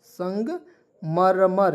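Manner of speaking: संगमरमर very slow, broken into parts; syllable by syllable. Shown as a demonstration. Teacher dictating the Hindi word for marble, syllable by syllable. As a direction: say संग, pause, then a drawn-out मरमर.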